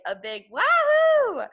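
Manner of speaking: high, loud, drawn-out wahoo; a kid's joyful cheer in the poem. Acted. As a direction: whoop it out with joy.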